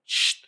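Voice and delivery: soft shush at noisy people; a quiet shh. Retold or imitated. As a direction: hush someone in the cinema. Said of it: "Y haces el".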